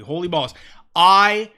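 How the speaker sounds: ordinary talk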